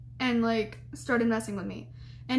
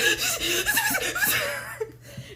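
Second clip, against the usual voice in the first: high squeaky hyperventilating, acted out; panicked gasping. Student seeing her boyfriend after the scare, high panicked gasping. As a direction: imitate frantic gasps, very high.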